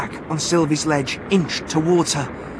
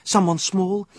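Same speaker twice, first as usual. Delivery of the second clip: ordinary delivery, nothing marked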